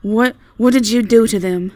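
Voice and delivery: alarmed shout; scared and loud. Acted. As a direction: confront him through the fear. Confronting the menacing stranger.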